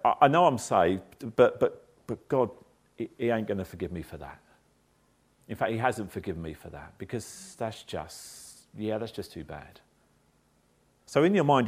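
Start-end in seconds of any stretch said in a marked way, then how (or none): 0.0-9.8 despairing. Retold or imitated. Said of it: "and you go".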